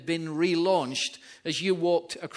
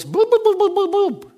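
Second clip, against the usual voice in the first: put-on very high, loud baby-talk coo; squeaky, loud grandmotherly baby talk; 8 dB louder than usual. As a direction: coo at the baby like a doting granny. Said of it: "and she goes".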